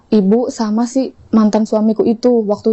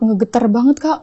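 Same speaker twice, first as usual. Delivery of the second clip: normal talking voice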